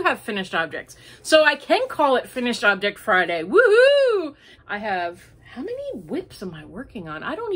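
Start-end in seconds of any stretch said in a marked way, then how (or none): 3.4-4.3 high, loud cheer; excited whoop. Real, not acted. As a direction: celebrate with a whoop. Celebrating finished objects.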